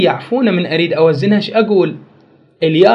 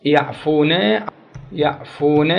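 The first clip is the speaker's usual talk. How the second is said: slow demo; much slower than usual. Shown as a demonstration. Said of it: "شوفوا الفرق بين الوزنين".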